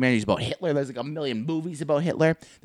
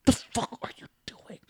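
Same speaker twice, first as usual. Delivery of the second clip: hissed whisper; 8 semitones higher, 8 dB quieter than usual. Acted. Klansman confronting burrito eater.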